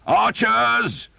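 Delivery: high loud call; shout again. Acted. Earl calling archers in battle, high loud shout. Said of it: "the Earl shouted".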